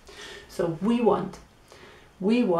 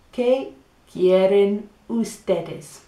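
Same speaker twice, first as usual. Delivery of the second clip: slow clear repeat; slower than usual. Shown as a demonstration. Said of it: "So how do you say it again?".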